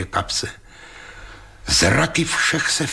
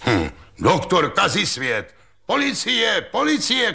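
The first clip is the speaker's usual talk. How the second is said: yelling for police; 10 semitones higher than usual. A narrator's reading. The strap-maker yells for the police, fearing for his money. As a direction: scream for the police in alarm. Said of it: "křičel řemenář".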